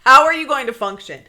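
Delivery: loud, high challenge; incredulous mock retort. Best friend challenging her about work, loud and incredulous. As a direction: throw the question out loudly in disbelief.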